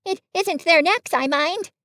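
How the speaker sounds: high, earnest little voice. A narrator's reading. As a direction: explain the worry in a small high voice. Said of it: "said Piglet earnestly".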